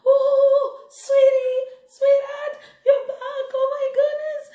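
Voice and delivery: sweet-talking wife laughing her husband in at the door; sugary welcome. Acted. Wife greets returning husband sweetly.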